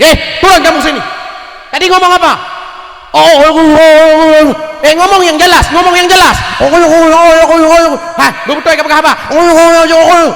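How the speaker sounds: loud, high, barking police; hammed-up angry officer; mock police shouting. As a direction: yell down at the crowd like a cop. Mocking police shouting at demonstrator.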